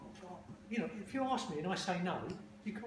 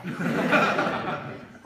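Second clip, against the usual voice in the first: big breathy laugh at his own punchline; loud burst of laughter; 12 dB louder than usual. Real, not acted. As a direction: let a real laugh burst out, loud and open.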